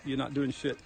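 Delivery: ordinary talk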